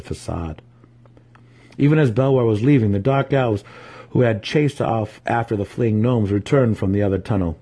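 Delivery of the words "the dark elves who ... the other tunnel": ordinary delivery, nothing marked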